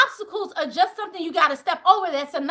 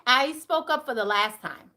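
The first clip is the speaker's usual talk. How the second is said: normal talking voice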